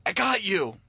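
high, urgent reassurance; retold burst of urgency; acted urgent rescue call. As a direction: cry out as you grab a drowning child. Father grabbing his drowning son.

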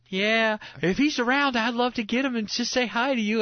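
high, imitated Southern lady; Southern accent. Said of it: "kind of a Southern accent".

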